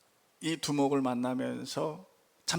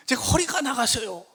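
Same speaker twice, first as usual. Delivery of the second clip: gang boss in pain; 9 semitones higher, 4 dB louder than usual. Retold or imitated. Injured boss telling the pastor his back is out, mildly whiny. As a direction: boss voice pitched high, a touch of a groan. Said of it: "갑자기 전화가 왔어요".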